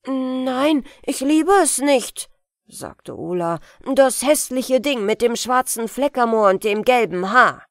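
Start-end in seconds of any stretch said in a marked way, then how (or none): 0.1-2.3 put-on a boy's voice, louder and higher; lightly voiced boy protesting; bewitched boy, loud denial. Boy Ola voiced in tale. 3.8-7.7 lightly voiced boy protesting; bewitched boy, loud denial. Boy Ola voiced in tale.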